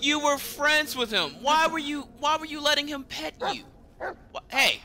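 scolding the dog. Real, not acted. Scolding his dog in game.

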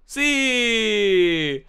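loud, high, drawn-out cheer; long triumphant yell; excited cry. Real, not acted. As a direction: stretch it out high and loud, sliding down. Delighted cry at finding apples.

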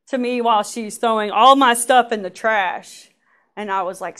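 normal talking voice

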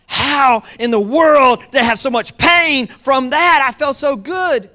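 slow, loud exclaiming; loud preaching. Acted. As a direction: belt it out to the church.

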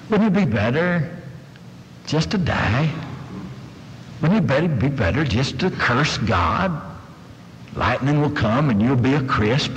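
gentle, low, halting endearments; coaxing wife. Acted. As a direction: counsel him tenderly, with long pauses. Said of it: "I think she comes out and says".

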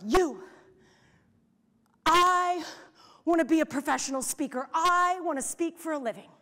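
loud, bold declaration; hollering her dream. Retold or imitated. Young professional announces her dream to five hundred strangers, loud. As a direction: holler it out with no fear. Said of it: "I hollered at the biggest dream".

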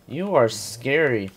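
slow, deliberate mock menace; drawn-out teasing repeat. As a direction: stretch each word out slowly. Player repeats line to creature.

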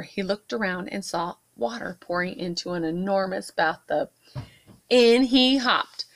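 usual speaking voice

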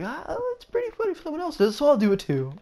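very high, silly mimicking voice; mock bandwagon follower. Mimics people jumping on the bandwagon.

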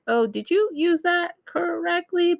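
high, questioning puzzled voice; head-tilted puzzled question; puzzled listener. Retold or imitated. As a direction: wonder aloud if they got it right. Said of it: "cock their head like".

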